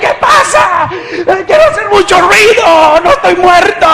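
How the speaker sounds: high, loud panicked shout; frantic cries at the top of the voice; desperate yelling. Retold or imitated. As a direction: yell to make someone hear you. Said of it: "yo estaba y grité".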